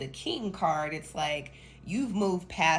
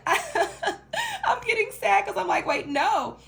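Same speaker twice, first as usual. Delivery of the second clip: laughing through the words, high and loud; giggly, laughing protest; 7 dB louder, much slower than usual. Real, not acted. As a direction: break into laughter while talking. Laughs mid-reading at own feeling.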